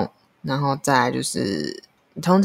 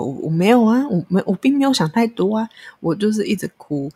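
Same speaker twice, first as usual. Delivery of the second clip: higher, quicker, stammered protest; indignant protest; 5 semitones higher, 3 dB louder, faster than usual. Retold or imitated. Caller objecting to being told not to overthink, indignant. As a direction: push back in exasperation, voice raised in pitch. Indignant retort to hotline advice.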